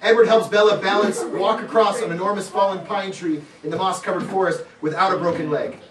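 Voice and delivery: normal talking voice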